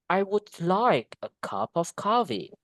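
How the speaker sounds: slow, long beats on "like", "cup", "coffee"; content-word stress. Shown as a demonstration. Teacher demonstrating content words, slow measured stress. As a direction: stretch "like", "cup", "coffee", keep "would" and "of" light. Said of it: "cup, coffee is a content word".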